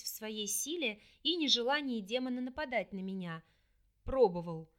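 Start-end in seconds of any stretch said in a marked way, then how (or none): 4.1-4.7 low, dry demon voice; dry reply. Acted. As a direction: answer curtly and low. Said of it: "последовал сухой ответ".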